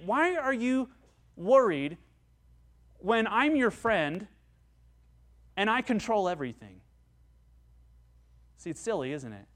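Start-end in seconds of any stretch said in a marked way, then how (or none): 0.1-6.8 higher, louder, slow gentle challenge; Jesus chides a worried friend; Jesus asking. Acted. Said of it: "As if Jesus is saying".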